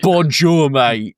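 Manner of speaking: put-on loud English-accented French, echoing the joke; hammed-up English accent on bonjour; mock English bonjour. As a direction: play the matey English tourist. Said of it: "'Cause you went".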